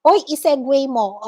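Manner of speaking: normal talking voice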